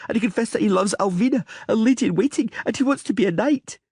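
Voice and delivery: put-on high girlish voice, stubborn and petulant; a spoiled little princess making her case; young girl Ciri. A little princess arguing with the Witcher, high girlish voice.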